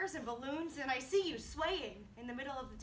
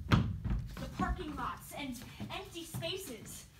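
rising from raised voice to high, full shout; louder and higher with every image, words stretched out; frantic, building desperation; 9 semitones higher, 12 dB louder than usual. Acted. Frantic search builds to a climax.

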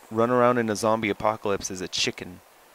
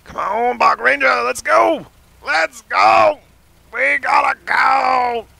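high, loud shout; excited shouting; 10 semitones higher, 9 dB louder, slower than usual. Real, not acted. Player shouting at the park ranger to drive off, high and loud.

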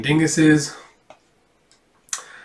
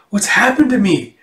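higher, astonished question; gasping wonder at a sudden change, acted; 6 semitones higher, 3 dB louder than usual. Girl struck by magic lightning.

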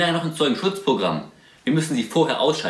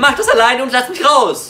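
loud and high, demanding out; angry shout; 9 semitones higher, 7 dB louder than usual. Acted. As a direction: yell to be let out. Couple quarrel in the car. (wütend schreien)

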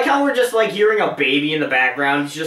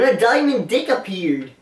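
goofy put-on dinosaur storyteller; Barney voice; 3 semitones higher than usual. Player repeating the line for a friend, Barney voice. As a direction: repeat it in the cartoon character voice. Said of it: "[name] does his Barney voice".

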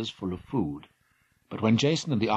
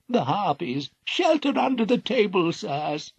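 put-on old king crying out in alarm, higher; frightened cry; 10 semitones higher, 4 dB louder than usual. Blind king warning Argonauts of harpies, frightened cry. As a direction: warn them in a panic. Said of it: "cried King Phineus".

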